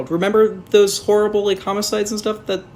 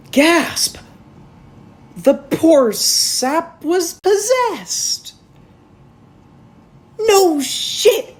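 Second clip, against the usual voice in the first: hammed-up surprise, much higher, slow, louder; mock gasp; 12 semitones higher, 5 dB louder, much slower than usual. Reviewer fakes shock at an obvious twist, much higher. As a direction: gasp and drag it out in fake shock.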